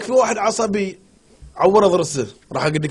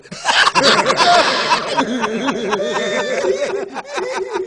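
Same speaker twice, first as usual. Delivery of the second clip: laughter; 4 semitones higher than usual. Real, not acted. Laughing at joke.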